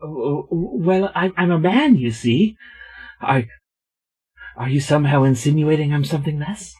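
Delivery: Mister Willowsby. Acted. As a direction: stammer in character as if mildly insulted. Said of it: "We're not in character yet".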